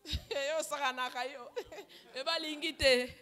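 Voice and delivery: real chuckles between the words; laughing. Real, not acted. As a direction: laugh softly through the prayer words. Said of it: "I'm laughing".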